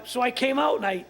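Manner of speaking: normal talking voice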